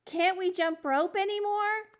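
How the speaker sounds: high, loud worried question; alarmed girl. Acted. Cricket alarmed, asking the principal, high and worried. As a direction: blurt it out, suddenly alarmed. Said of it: "Cricket looked alarmed".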